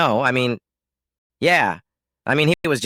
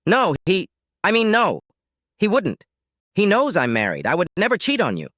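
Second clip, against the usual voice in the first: higher-pitched flustered denial; protesting; 8 semitones higher than usual. Acted. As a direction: protest your innocence, voice pitched up. Wife denies affair in quarrel.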